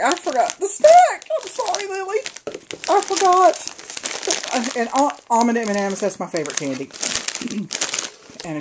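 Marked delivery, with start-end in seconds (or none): 0.0-4.8 high-pitched, drawn-out dismay at forgetting; dismayed apology. Real, not acted. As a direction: wail an apology to a friend in a high voice. Dismayed apology for forgotten snack.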